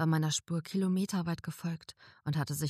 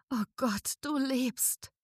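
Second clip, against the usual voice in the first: higher, rejoicing exclamation; joyful cry; 9 semitones higher, slower than usual. A narrator's reading. Narrator finding her friend alive, joyful cry. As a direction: cry out with relief. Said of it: "jauchzte ich".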